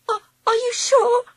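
high, stammering frightened quaver near tears. A narrator's reading. Angela asks Charlie if he's sure, quavering in fear. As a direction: let the voice tremble with fear. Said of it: "she quavered".

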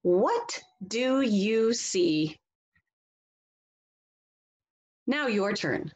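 slow, sing-song storybook reading; reading to children. Acted. Teacher reading a picture book to the class, slow and expressive. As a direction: read it slowly and expressively for young listeners.

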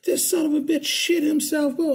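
high, loud mock outrage; girl going off. Girl exposing the man to the room, going off. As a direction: imitate a girlfriend ranting at full tilt. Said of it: "This girl's going off".

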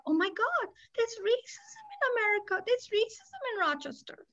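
high, breathy-soft mock gasp of discovery, pitch way up; sarcastic mock shock. Mocking the bankers who just discovered racism, soft and high. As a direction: sarcastically play the bankers' fake surprise. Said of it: "I shouldn't be making sarcastic noises".

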